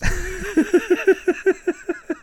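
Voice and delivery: high-pitched burst of laughter. Real, not acted. Laughs at own joke.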